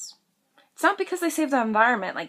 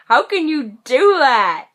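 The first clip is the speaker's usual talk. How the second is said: loud, slow, higher-pitched outrage; appalled protest; 4 semitones higher, 8 dB louder, slower than usual. Acted. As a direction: confront a dog kicker, appalled. Acted protest to dog kickers.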